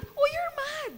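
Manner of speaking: high, light imitation of a small boy; child's voice. Son Rafa insisting his mother is mad, high child voice. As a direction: voice the little son teasing. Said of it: "Rafa started telling me".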